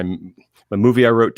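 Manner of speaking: ordinary delivery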